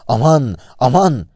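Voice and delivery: narrated yell for help, well projected; loud panicked cry. A narrator's reading. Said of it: "avazı çıktığı kadar".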